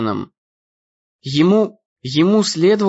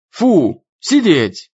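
dog command; 2 semitones higher, 3 dB louder, much slower than usual. A narrator's reading. Alistair stops the dog with loud commands. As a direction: order the dog to stop, loudly.

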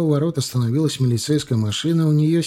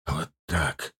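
bitter; 8 semitones lower, 8 dB quieter than usual. Acted. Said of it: "с горечью ответил ей муж".

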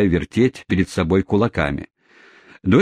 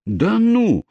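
very high astonished cry; exclaiming; 14 semitones higher than usual. Acted. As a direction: gasp it out in disbelief. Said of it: "воскликнул какой-то другой кэбмен".

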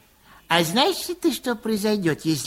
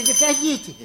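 ordinary talk, nothing marked

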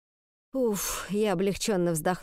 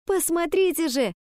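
high, urgent plea; insistent exclamation; 8 semitones higher than usual. A narrator's reading. Girl jabbing at the letter before her teacher, insistent exclamation. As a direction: exclaim it high, demanding attention. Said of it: "воскликнула она".

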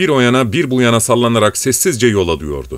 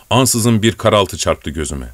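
usual speaking voice

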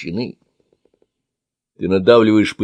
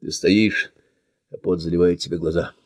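plain reading voice

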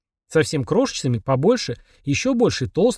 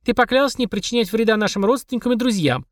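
put-on high, indignant kid voice; a child holding him to his oath; 8 semitones higher than usual. Child accuses demon.

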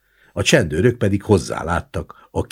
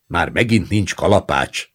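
mildly raised complaint; grumbling; 3 semitones higher, 4 dB louder than usual. A narrator's reading. Taller gendarme lacking a hammer again, grumbling. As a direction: grumble with a touch of annoyance. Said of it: "elégedetlenkedett a magasabb".

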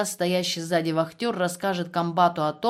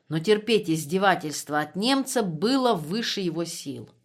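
ordinary delivery, nothing marked

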